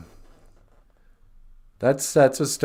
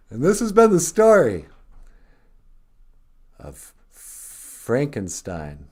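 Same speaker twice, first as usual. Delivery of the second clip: story closing; 5 dB louder, slower than usual. A narrator's reading. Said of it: "closing the tale".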